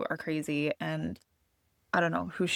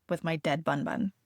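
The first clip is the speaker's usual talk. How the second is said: ordinary delivery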